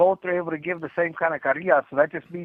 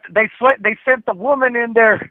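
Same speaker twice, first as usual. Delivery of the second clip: excited teasing; 8 semitones higher, 7 dB louder than usual. Real, not acted. Teasing rant about the defector.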